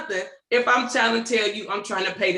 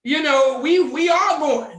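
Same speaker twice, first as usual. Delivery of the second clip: loud slow mock insistence; 3 semitones higher, 5 dB louder, slower than usual. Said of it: "I'm like".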